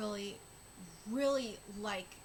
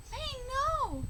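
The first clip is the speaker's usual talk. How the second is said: anguished cry; 14 semitones higher, 5 dB louder than usual. Acted. Character sees May fall.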